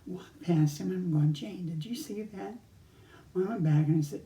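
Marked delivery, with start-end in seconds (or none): 1.3-2.5 hushed aside. Retold or imitated. Astonished aside to wife.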